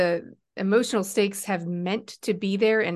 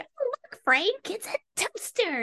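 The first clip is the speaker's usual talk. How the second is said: very high, squeaky ditzy voice; Carol Kane impression. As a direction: squeak it out sweet and daffy. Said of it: "beating Bill Murray with a toaster".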